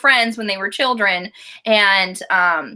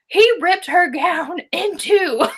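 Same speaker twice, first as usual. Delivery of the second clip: mock dramatic reading; 8 semitones higher, slower than usual. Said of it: "I was just like".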